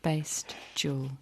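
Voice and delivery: slow hushed whisper. Acted. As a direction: breathe it out slowly.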